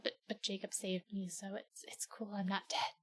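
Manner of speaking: fading to a sheepish mumble; half-hearted excuse fading out; trailing off. Retold or imitated. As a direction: shrink away as you explain.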